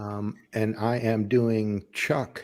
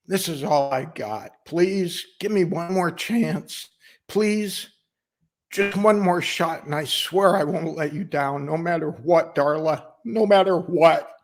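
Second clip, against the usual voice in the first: begging, rising higher on each plea; desperate pleading; 9 semitones higher than usual. Acted. Worker begs Darla for one more shot, pleading hard. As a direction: beg for it, drawing out the pleas.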